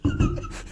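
squealing giggle; high squeaky laugh. Real, not acted. Listener reacting to the story with a high squeal. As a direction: let out a tiny high squeal.

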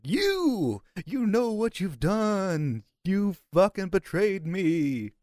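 high, slow, drawn-out ghost voice; ghostly wail. Acted. Player haunting men as a ghost: eerie high wail. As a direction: wail the accusation long and high.

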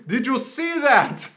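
big high Austrian ho-ho laugh, then drawn-out question; hammed-up jolly Austrian accent; delighted Austrian man. Austrian hydraulic press video host to viewers, delighted. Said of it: "a delightful Austrian man going".

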